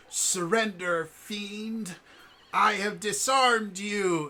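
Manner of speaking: high, loud, drawn-out mock-heroic proclamation; play-acting kid hero. Child to fallen playmate in a sword game, grandly triumphant. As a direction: declaim it like a child playing the conquering hero. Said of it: "the one standing over goes".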